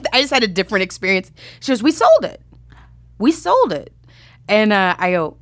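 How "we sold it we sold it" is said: excited. Retold or imitated. Producer announces the sale.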